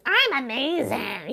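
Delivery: high, slow, sing-song fake cheer; mock-bright denial. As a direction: chirp it like an obvious lie. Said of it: "you don't wanna lie and be like".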